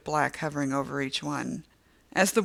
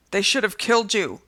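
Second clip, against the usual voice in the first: high, louder scream, hitting killed; 5 semitones higher, 8 dB louder than usual. A narrator's reading. Woman screaming through the glass door. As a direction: spit it with hatred. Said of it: "the woman screams".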